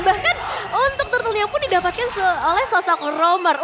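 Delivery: loud excited play-by-play; excited shout. Real, not acted. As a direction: yell the big play. Caster hyped at objective steal.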